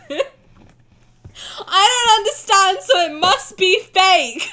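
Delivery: high, slow, laughing and loud; laughing self-mockery. Real, not acted. Streamer mocking her own distrust, laughing slowly. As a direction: laugh through your own silly logic. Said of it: "'cause I know everything".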